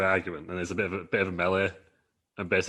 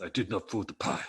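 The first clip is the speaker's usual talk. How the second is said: put-on Italian accent; 4 dB quieter than usual. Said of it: "comes out and goes".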